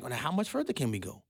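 a quiet smug plotter, lightly voiced; mock scheming politician. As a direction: whisper it like a conspirator.

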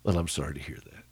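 soft, low, kindly sympathy. Retold or imitated. To the man at church, a soft and kindly brush-off. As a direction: ease him off gently, with kindness. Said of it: "I said very kindly".

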